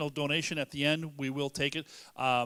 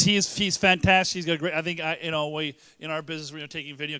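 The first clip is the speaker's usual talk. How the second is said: ordinary delivery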